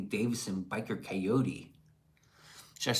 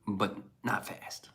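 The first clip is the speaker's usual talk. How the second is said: whisper; 2 semitones lower, 4 dB quieter, slower than usual. Real, not acted. Aside while explaining.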